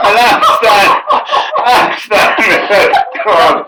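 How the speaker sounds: high and loud words broken by laughter; laughing while talking. Real, not acted. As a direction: push the words out between laughs. Laughing after the punchline.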